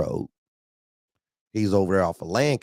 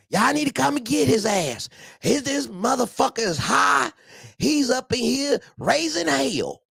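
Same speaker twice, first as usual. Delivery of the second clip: angry woman caller. Retold or imitated. A woman calls the boss to come get the driver, high angry woman's voice. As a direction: threaten like a fed-up woman ready to shoot. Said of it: "This time it's a female".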